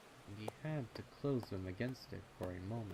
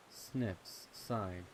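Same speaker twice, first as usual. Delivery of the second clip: usual speaking voice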